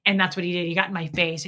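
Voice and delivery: ordinary talk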